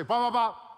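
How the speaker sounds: loud, high, playful sound effect; demonstrated "wop" noise. Shown as a demonstration. Preacher getting the congregation to say it with him, loud and playful. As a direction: belt out three quick wops. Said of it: "say it with me".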